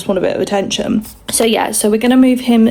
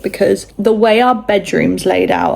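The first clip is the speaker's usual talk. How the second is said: usual speaking voice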